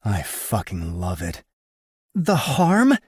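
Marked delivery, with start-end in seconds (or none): 2.2-3.0 high incredulous woman's voice; disbelieving, pitched up high; gawking. A narrator's reading. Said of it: "she gawks".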